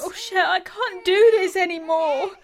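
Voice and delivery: tearful, breaking laugh-sob, slowed; crying. Acted. Katie to her friend Cher, crying it all out. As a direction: voice cracking between laugh and tears.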